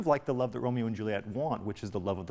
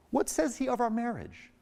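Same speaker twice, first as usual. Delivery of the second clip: eager; 13 semitones higher than usual. Acted. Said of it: "Juliet says back to her".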